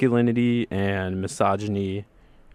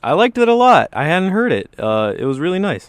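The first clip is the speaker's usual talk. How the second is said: high, loud and excited; 9 semitones higher, 7 dB louder than usual. Real, not acted. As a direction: gush about it. Excited reaction.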